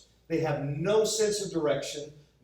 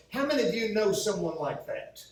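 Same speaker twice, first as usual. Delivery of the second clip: ordinary talk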